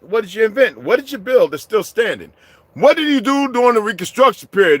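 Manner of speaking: ordinary talk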